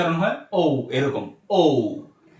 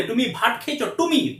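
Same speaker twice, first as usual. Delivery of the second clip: mock foreign accent in Bengali; foreigner's accent; 4 semitones higher, 3 dB quieter than usual. Foreign learner trying out Bengali, foreign accent. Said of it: "কোনো foreigner এরকম করে বাংলা শিখালে এরকম করেই বলবে".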